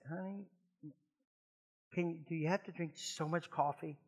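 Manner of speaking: very soft, lightly imitated wife; gentle imitated plea. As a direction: plead quietly, as if early in the morning. Imitates wife's early-morning plea.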